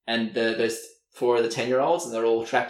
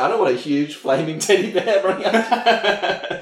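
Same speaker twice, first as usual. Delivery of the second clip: laughing protest; 5 semitones higher, 5 dB louder than usual. Retold or imitated. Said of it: "The guy's like".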